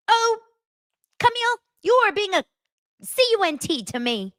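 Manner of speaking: very high, fast, sing-song mock voice; imitating Dorit. Dorit dismissively insulting Camille in a high, fast mock voice.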